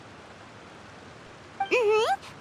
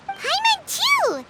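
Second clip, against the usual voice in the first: excited; 9 semitones higher, much slower than usual. Real, not acted. Eager agreement, high and slow.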